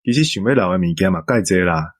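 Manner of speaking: ordinary delivery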